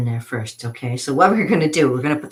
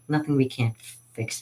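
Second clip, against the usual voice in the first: ordinary delivery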